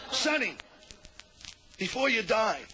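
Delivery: high, pleading voice; melodramatic plea. Acted. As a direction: beg him before he goes. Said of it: "He says".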